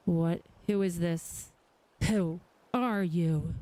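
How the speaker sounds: ordinary talk, nothing marked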